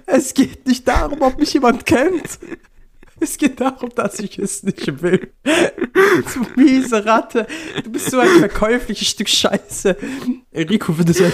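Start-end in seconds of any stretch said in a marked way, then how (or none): 0.1-10.0 hohe, gepresste Lachstimme; Tränen lachen. Real, not acted. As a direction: vor Lachen kaum sprechen können. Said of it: "ich hab Tränen in den Augen". (unter Lachtränen schimpfen)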